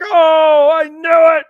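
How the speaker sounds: husband shouting his joy at the news; excited shout. Retold or imitated. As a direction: burst out with joy. Husband reacts to pregnancy news.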